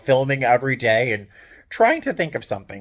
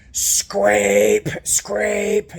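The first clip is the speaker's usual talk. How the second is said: scraping noise; 8 semitones higher, 3 dB louder than usual. Retold or imitated. Metal dragged at night.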